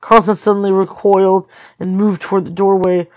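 usual speaking voice

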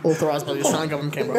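fast, low government disclaimer. Pretend. Tagging the joke ad, rapid official disclaimer. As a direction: rattle it off low like fine print.

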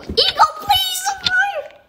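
high, desperate call for help; panicked plea. Real, not acted. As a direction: beg a friend to save you. Begging teammate in danger.

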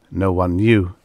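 ordinary delivery, nothing marked

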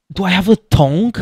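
rising excited do I have a tongue; excited question. Real, not acted. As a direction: blurt it out, thrilled. Discovering the snake's tongue.

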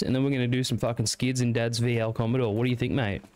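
broad rural Bogan, starting high-pitched and easing down; Bogan accent. Shown as a demonstration. Streamer showing how his country family speaks, broad Bogan accent. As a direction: rattle it off like a country Bogan mate. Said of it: "How do you do your Bogan impression?".

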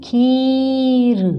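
very slow खीर with the ई held long; teacher stretching खीर; drawn-out long ई. Shown as a demonstration. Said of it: "थोड़ा सा खींच के बोलना है".

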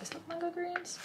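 high, surprised question to herself; puzzled sniff, then pitch it up high; lightly voiced surprise; surprised inner question. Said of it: "I smelled it there and thought".

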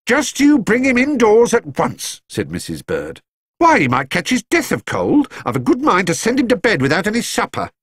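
put-on high, bossy elderly lady's voice, quickening; scolding housekeeper. A narrator's reading. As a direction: fuss and scold like a stern housekeeper. Mrs Bird voiced, scolding.

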